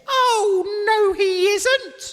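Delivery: slow, loud drawn-out no; panto denial. Acted. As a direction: drag out the denial, panto style. Panto call and response.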